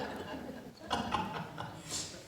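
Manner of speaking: soft airy chuckle; breathy laugh. Real, not acted. Preacher laughing at congregation's blank faces, soft airy chuckle.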